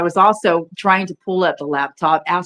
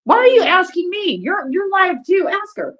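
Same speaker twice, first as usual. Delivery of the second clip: mock exasperation; 13 semitones higher than usual. Real, not acted. Refusing to answer the gong question, in a high mock-indignant squeak.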